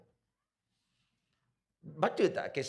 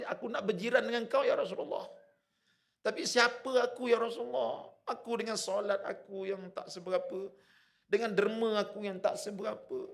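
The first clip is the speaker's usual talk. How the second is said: high-pitched, impassioned acted plea; tearful companion; 10 semitones higher, 3 dB louder than usual. Companion tells the Prophet he longs to be his neighbour, tearful pleading. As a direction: plead humbly, voice raised high as if near tears. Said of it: "Tiba-tiba dia menangis".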